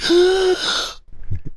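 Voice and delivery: a big mock gasp of shock; imitated audience gasp. Retelling the crowd's shock, a sharp high gasp. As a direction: gasp like a stunned theater crowd. Audience gasps at movie end.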